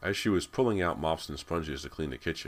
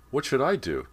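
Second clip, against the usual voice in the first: much higher and louder, keen to help; eager question; 10 semitones higher, 7 dB louder than usual. A narrator's reading. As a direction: ask it up eagerly. Callie eager on the ladder.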